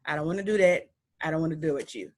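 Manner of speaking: normal talking voice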